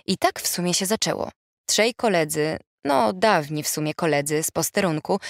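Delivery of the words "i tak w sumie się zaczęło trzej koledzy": ordinary delivery, nothing marked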